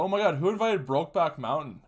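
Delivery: mock astonishment. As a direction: call out the wisecrack to the whole room. Re-enacting his joke at the dinner.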